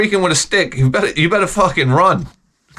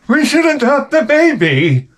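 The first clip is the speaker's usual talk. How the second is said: higher, pleading wife voice; lightly hammed-up wife, pitched up; husband mimics his wife's suggestion, higher and whiny; mock wife; 10 semitones higher, 3 dB louder than usual. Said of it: "my wife".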